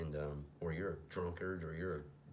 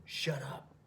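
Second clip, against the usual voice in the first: higher, sharper shut up; firm command; 7 semitones higher than usual. Retold or imitated. Thomas silencing his demon firmly. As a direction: snap back at the inner voice. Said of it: "That's what Thomas says to him".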